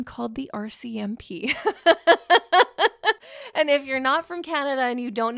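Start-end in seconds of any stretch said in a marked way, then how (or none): 1.6-3.5 loud, high giggling burst; burst of laughter. Real, not acted. Confessing calling the police on neighbours, loud giggle. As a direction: laugh at your own awful deed.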